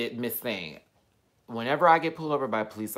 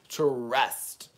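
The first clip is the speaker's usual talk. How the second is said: drawn-out word through a laugh. Real, not acted. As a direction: let a real laugh spill round it. Laughing as she repeats the word.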